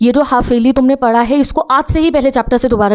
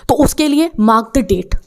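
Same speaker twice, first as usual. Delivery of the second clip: slow instruction; slower than usual. Shown as a demonstration. Teacher giving instruction.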